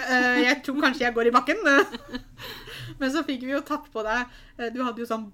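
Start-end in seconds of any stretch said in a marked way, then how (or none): 0.1-3.0 laughing, loud, high nervous quip; shaky laughing. Retold or imitated. Nervous friend at the party, laughing loudly. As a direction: laugh through the nerves. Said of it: "sånn skjelvemodus".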